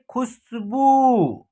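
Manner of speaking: खुशबू with short खु and long बू; drawn-out ending. Shown as a demonstration. Teacher introducing खुशबू to learners, last syllable stretched. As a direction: hold the final ऊ of खुशबू. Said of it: "इसका उच्चारण खींच करके होगा".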